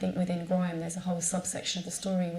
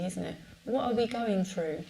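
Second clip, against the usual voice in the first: ordinary delivery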